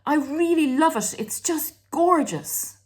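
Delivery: put-on high, pleading little child voice; child begging parent for a shiny toy car, whiny and high. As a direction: beg like a kid at the toy shop. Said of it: "when a child... say".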